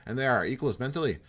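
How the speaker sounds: light Irish lilt on a friendly loner; a hint of Irish brogue; Irish-tinged accent. Jim admitting to Porto he likes being alone, lightly Irish. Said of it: "my Minnesota just turned Irish".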